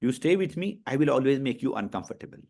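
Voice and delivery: ordinary talk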